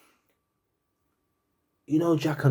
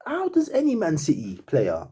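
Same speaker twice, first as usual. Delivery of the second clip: normal talking voice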